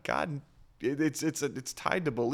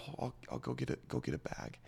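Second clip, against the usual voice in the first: much softer, fading into a whisper; hushed mom; 14 dB quieter, faster than usual. Retold or imitated. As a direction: drop to a whisper as the mom on the phone. Mom quietly answers on phone.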